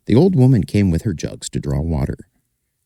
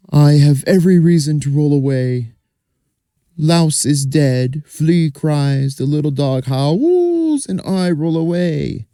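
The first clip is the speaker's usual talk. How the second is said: a small cart explaining its sorrow, higher; 9 semitones higher than usual. Acted. Cart answering the manure pile, higher voice.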